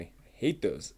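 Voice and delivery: ordinary talk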